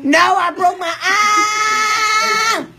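loud protest, last word stretched out; yelling. Real, not acted. Answering about baseball tomorrow, loud yell. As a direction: yell it, wailing on the final word. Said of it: "No more yelling".

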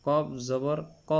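full-mouthed Arabic qaf on "ক"; thick qaf. Shown as a demonstration. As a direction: say "ক" heavy, with a rounded vowel. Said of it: "কা বলা যাবে না".

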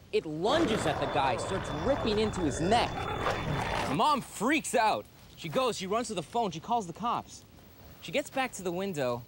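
high, punchy account of an attack; urgent storytelling. Acted. Man telling friends of the bulldog's attack, urgent storytelling.

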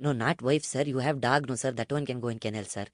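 low, soft, quick deferential patter; meek broker. Acted. Broker passes on owner's odd proposal, meek low murmur. Prank caller as broker Nagesh.

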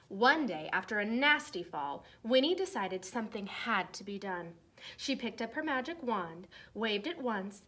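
lively storybook read-aloud voice; reading to children. Acted. Teacher reading a Halloween book to her class, lively read-aloud.

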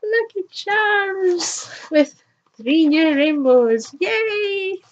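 very high, drawn-out cheer; gleeful reveal. Real, not acted. Unboxer revealing Lucky Charms as last thing, gleeful squeal.

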